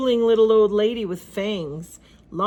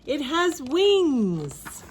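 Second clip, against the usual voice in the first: slow, higher voice of disbelief; dismayed disbelief; 7 semitones higher, much slower than usual. A narrator's reading. Inventor pig finding wings on his creation, slow dismay. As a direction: drag it out in dismay. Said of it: "he cried".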